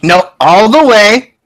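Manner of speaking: slow, loud motherly command; stern mother brooking no argument; mom voice. Acted. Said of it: "this is motherly intimidation".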